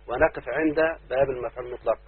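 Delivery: normal talking voice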